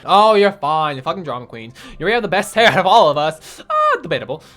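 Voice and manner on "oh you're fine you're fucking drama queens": usual speaking voice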